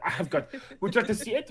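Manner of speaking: cat offering a rat, fast and giggly; mock cat voice, fast, laughing. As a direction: rush it out, laughing. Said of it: "he was like".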